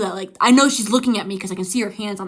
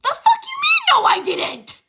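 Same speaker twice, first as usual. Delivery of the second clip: furious that mom denies it; indignant yell; slower than usual. Retold or imitated. Yelling at mom who says she saw nothing. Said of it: "And I'm like".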